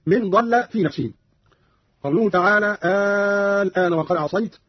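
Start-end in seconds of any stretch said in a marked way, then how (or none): none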